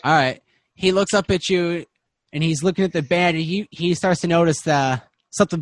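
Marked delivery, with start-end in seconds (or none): none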